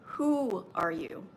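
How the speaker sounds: slow, insistent otherworldly voice; a lightly voiced inner voice pressing the question; voice heard in meditation. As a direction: ask it slowly like a voice from beyond. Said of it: "And I heard".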